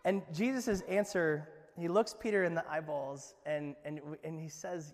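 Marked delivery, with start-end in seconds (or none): none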